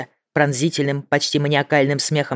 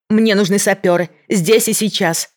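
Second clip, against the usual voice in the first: terrified scream; 5 semitones higher, 4 dB louder than usual. A narrator's reading. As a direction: shout it out in horror. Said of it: "в ужасе закричала Мэгги".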